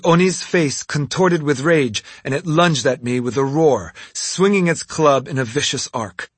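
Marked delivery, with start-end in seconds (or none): none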